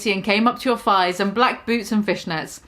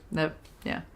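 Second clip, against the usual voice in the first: under-breath aside; 6 semitones lower, 13 dB quieter than usual. Real, not acted. Muttered self-correction aside.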